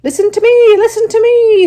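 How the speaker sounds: very high, very loud goose scream; the goose screams at Wilbur, very high and loud. Acted. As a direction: scream for his attention. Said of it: "screamed the goose".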